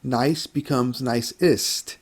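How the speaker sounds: slowed down so each ending is heard; slow, clear model sentence. Shown as a demonstration. Teacher modeling the comparative for students, slow and clear. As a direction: spell it out slowly for students. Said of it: "Or as a superlative".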